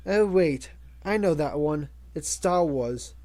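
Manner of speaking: plain reading voice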